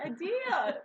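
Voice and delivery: high squeal breaking into laughter; mock-tearful joy. Granddaughter answering grandma, overjoyed squeal. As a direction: squeak it out as a happy cry.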